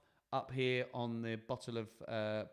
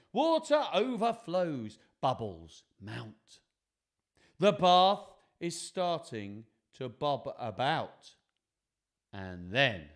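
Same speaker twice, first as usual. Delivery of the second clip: suspenseful picture-book narration; 7 dB louder, slower than usual. A narrator's reading. Telling children the bath is bobbing, building suspense. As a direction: draw out the build-up.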